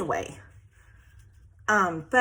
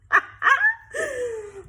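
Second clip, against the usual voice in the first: high, helpless burst of laughter; tickled cackle; real laugh; 14 semitones higher, 4 dB louder than usual. Real, not acted. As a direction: crack up at your own joke. Laughing at her own joke.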